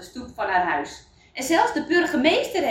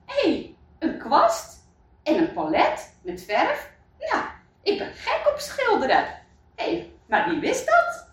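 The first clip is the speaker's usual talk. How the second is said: put-on much higher, delighted girl voice with a laugh; excited Lucia; 8 semitones higher than usual. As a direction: squeal with joy over the paintbrush. Lucia opens her gift. (veel hogere, blije meisjesstem met lach)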